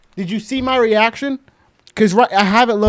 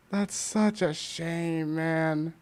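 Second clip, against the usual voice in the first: sad; 4 semitones lower, 11 dB quieter, much slower than usual. Real, not acted. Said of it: "I was heartbroken".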